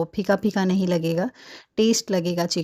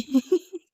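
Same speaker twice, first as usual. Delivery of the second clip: a burst of high, bright giggling at her own mix-up; real giggle; 10 semitones higher than usual. Real, not acted. Laughing while telling viewers about the pepper mix-up. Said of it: "मुझे इतनी हंसी आ रही थी".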